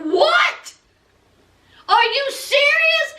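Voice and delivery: high-pitched incredulous outburst; shocked disbelief. Retold or imitated. Retold father's shocked reaction.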